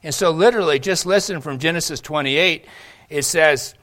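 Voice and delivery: loud, rising sermon voice; preaching. Real, not acted. Pastor urging his congregation on God's grace, preaching loudly. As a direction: preach it with full conviction. Preaching builds to full force.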